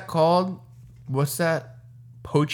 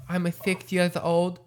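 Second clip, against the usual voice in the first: put-on much higher, softer child voice, slowly; little kid voice; 5 semitones higher, 3 dB quieter than usual. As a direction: squeak it out like a shy six-year-old. Kid voice again.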